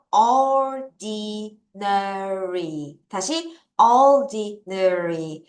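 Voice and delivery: very slow, each syllable apart; drilling ordinary by syllables. Shown as a demonstration. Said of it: "음절대로".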